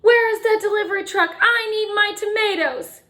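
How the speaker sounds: high, loud and fast yell; yelling grocer. A narrator's reading. Grocer yelling on the sidewalk for his delivery truck. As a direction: bellow it out for the missing truck. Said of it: "and yelled".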